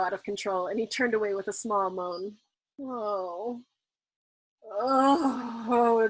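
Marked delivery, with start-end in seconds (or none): 2.8-6.1 moaning back. A narrator's reading. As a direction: moan back at him, teasing. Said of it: "Dunbar moaned back at him".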